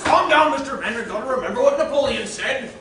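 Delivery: urgent restraining. Acted. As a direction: talk over the master's rage. Servant restraining his furious master.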